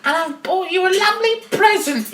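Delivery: put-on high child voice calling out; little girl. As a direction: pipe up as a young visitor. Child at grandma's door.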